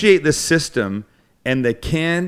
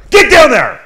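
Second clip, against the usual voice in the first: much higher, louder drill bark; barking gym coach; 10 semitones higher, 10 dB louder than usual. Pretend. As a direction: bark the order like a drill sergeant. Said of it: "you just scared the crap out of Lucky".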